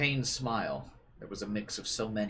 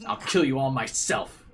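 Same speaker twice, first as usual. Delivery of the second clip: furious vow; 5 semitones higher, 8 dB louder than usual. Acted. Vowing revenge on everyone.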